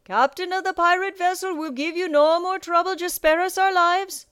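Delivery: high, loud conceding call; captain shouting a plea for mercy; Captain Nightlighter. A narrator's reading. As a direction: call out the surrender to both crews. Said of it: "Captain Nightlighter called out".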